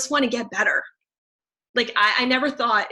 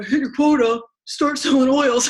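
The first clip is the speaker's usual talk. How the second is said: loud, put-on sales-boss voice; mock pushy salesperson; 3 semitones higher, 6 dB louder than usual. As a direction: push it like a caricature of a sales drill. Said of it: "we don't even talk like that".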